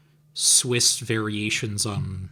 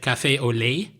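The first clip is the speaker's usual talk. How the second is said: put-on British-accented French. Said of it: "she had a British accent in her French".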